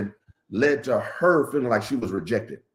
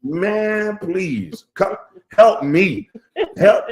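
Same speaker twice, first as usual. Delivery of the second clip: high, loud comic begging for help; half-laughing plea to God; mock plea; 7 semitones higher, 6 dB louder than usual. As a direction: beg the heavens for help, hammed up.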